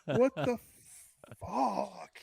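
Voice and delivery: high, stunned what the fuck; utterly baffled outburst; incredulous. Real, not acted. Reacting to prequel news.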